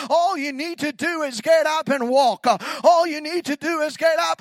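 loud rising declaration, pressing each phrase; preaching at full force. Acted. Preacher shouting the promise to the church, full force.

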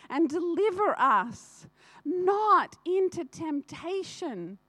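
says deliver us not into temptation, slow and even, not noticing the slip; misquoted prayer line. Shown as a demonstration. Reciting the Lord's Prayer to the congregation, slow and earnest. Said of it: "What is that, right?".

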